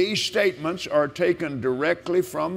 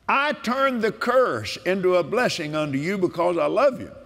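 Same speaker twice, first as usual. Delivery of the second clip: preaching with force; 3 semitones higher than usual. Acted. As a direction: declare it like a sermon. Proclaims scripture.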